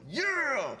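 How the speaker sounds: excited yell. Retold or imitated. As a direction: cheer it like you've been waiting all season. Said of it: "I got excited for nothing".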